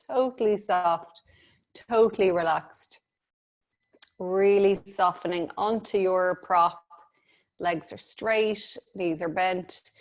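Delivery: calm, unhurried meditation voice; guided meditation. Acted. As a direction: guide the breathing gently. Leads breathing meditation.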